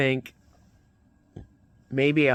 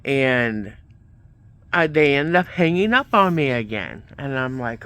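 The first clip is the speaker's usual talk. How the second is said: ordinary talk, nothing marked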